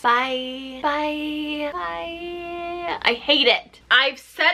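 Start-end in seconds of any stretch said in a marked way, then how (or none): 0.0-3.0 soft, fading mock of Crystal saying bye; Crystal's breathy goodbye. Mocking Crystal's voice from the group date, fading byes. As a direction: trail off each bye softer, imitating Crystal. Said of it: "I can still hear Crystal's voice".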